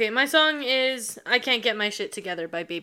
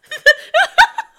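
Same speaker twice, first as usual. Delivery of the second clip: high, loud laughing fit; 12 dB louder than usual. Real, not acted. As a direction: burst into laughter. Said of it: "Why are you laughing so hard?".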